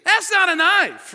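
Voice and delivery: high, shouted film-catchphrase imitation; Aussie hero quote at preaching volume; Nick Dundee. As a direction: throw the bushman's line out loud. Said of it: "All of a sudden he goes".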